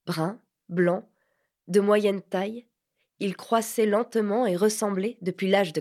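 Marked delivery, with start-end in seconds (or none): none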